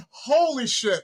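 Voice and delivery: stunned disbelief. Retold or imitated. Retold shock at crying crowd.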